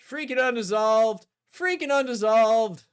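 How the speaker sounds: loud grumble of annoyance; frustrated outburst. Real, not acted. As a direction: vent at the game.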